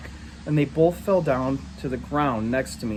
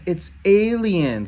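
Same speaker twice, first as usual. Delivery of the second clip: slow, high, louder jeer; 6 semitones higher, 5 dB louder, much slower than usual. Acted. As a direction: stretch the insult out. Kid jeering at the boy.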